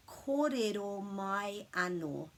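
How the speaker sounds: "Kōrero mai anō" spelled out slowly; "Kōrero mai anō", slow and even; slow clear demo. Shown as a demonstration. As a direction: teach each word deliberately. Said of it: "you could say".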